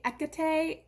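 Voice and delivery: "Ekate" with no H, three syllables ending in ay; H left silent. Shown as a demonstration. Lecturer showing a possible Greek dialect form, H left silent. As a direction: say "Ekate" as EK-uh-tay. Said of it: "don't pronounce the H at all".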